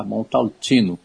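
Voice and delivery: Montalcino closing on "tchino"; correct Italian pronunciation. Shown as a demonstration. Expert confirms for listeners, Montalcino with Italian "tchi". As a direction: repeat Montalcino as "mon-tal-tchi-no". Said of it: "e não Montalcino".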